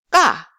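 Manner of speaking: "ga" short, loud, with tight k; hard, punched Korean tense "ga". Shown as a demonstration. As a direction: pop out "ga" without a puff. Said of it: "let's just practice one more time".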